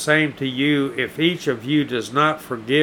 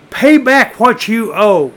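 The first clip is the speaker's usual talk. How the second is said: angry demand; 6 semitones higher, 6 dB louder than usual. Acted. Slave choking his fellow.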